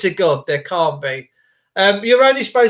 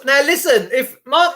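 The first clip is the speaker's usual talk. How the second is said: normal talking voice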